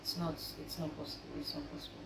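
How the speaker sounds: breathy whisper, stunned, repeating; a hushed whisper of shock; whispered disbelief. Retold or imitated. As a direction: whisper it like you can't accept it.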